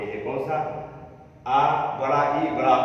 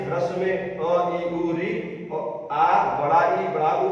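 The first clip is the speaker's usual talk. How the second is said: slow dictation; slower than usual. Shown as a demonstration. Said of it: "तो आप लोग याद रखिए".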